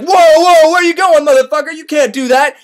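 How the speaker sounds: high, loud outraged challenge; alarmed shout. Retold or imitated. Soldiers to deserter walking off, alarmed shout. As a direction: yell to stop someone in their tracks.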